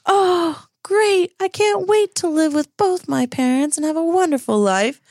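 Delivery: high, sarcastic whine, words stretched with mock delight; sarcastic kid. Freddy mocking his parents' pretend reunion, high and sarcastic. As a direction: drip fake enthusiasm like a bratty preteen. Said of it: "Freddy's like".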